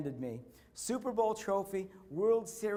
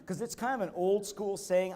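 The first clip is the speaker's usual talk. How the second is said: ordinary talk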